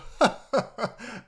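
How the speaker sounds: loud, high put-on laugh; cocky fake cackle; mock defiant laugh. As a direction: imitate a kid laughing off dad. Acts toddler laughing at dad.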